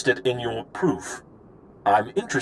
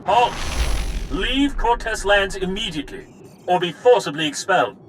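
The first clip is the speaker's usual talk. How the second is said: high, raised guard's order; commanding challenge; 6 semitones higher than usual. Acted. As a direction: call out a stern warning to an intruder. Guard challenges an intruder at gate.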